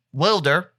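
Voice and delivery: "Wilder" with first syllable like "will"; "Wilder" pronounced with a short i; short-i pronunciation. Shown as a demonstration. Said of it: "It's one of those".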